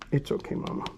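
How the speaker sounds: soft unvoiced whisper to a nervous dog; whispering comfort to the dog. Real, not acted. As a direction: breathe it gently to calm her. Soothing the dog while cleaning.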